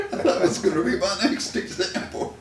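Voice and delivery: high, loud, fast laughing delight; delighted the host got there first; laughing excitement. Real, not acted. Praising the host for guessing his next example, laughing fast.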